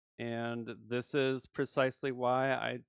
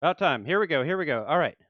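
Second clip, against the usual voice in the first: louder, higher, pumped up for the pull; eager excitement; 4 semitones higher, 11 dB louder, faster than usual. Real, not acted. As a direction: cheer the pull on as it lands. Gacha pull animation finally starts.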